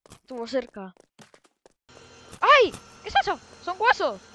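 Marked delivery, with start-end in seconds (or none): 2.4-4.2 startled. Real, not acted. Startled by finding bones.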